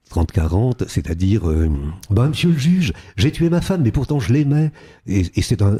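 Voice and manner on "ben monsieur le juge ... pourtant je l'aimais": much higher, naive Provençal accent from 1930s films; old film southern accent. Shown as a demonstration. A 1930s film southerner confessing to the judge, naive Provençal accent. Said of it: "un accent complètement sur le palais, un peu naïf".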